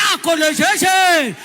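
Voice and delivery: high shouting; Yoruba drivers siding with her. Retold or imitated. Yoruba drivers shouting her defense, high and loud. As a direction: back her up loudly like motor park men.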